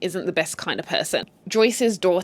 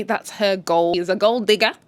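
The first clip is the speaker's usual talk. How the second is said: normal talking voice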